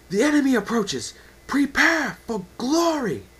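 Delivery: rally the troops with all you've got; battle cry. Acted. Commander rallying troops before battle.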